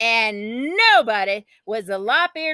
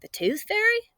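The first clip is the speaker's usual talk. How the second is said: much higher, puzzled question; asking; 12 semitones higher, 4 dB quieter than usual. A narrator's reading. Brother asking his sister who stole it. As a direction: ask it in disbelief. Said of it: "Bodine asked".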